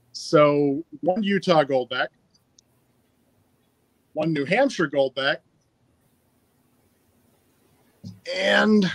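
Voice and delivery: audible breathing between words; heavy breathing. Real, not acted. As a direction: talk with breath clearly heard. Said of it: "sorry if you can hear me breathing".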